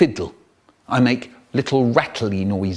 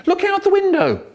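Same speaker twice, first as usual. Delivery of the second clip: mum's much higher, forced cheerful call; mock excited mum. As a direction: sell the window like it's a treat, high and bright. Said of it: "Mum tries to be exciting again".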